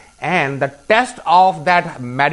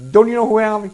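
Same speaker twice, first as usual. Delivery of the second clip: indignant; 8 semitones higher, 4 dB louder, faster than usual. Retold or imitated. Retold angry man stepped on.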